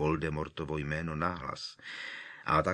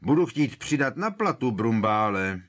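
higher, louder call from a distance; calling from afar; 5 semitones higher, 7 dB louder than usual. A narrator's reading. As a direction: shout it down the garden path. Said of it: "donesl se k nim znovu Křiklanův hlas".

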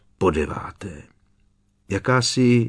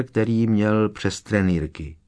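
ordinary delivery, nothing marked

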